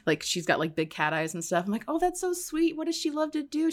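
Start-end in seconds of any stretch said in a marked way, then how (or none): 1.9-3.7 gushing sweet talk. Retold or imitated. Retold sweet-talking the mechanic.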